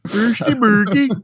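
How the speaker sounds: high, drawn-out mock-Swedish gibberish. Teasing co-host about the Swedish accent, mock Swedish. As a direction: stretch out a silly singsong Swedish accent. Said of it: "my Swedish accent".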